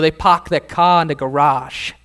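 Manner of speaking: mock Boston accent, dropped r's; put-on Boston speech; Bostonian accent. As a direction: talk like a Bostonian, no r in park or car. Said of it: "how do Bostonians talk?".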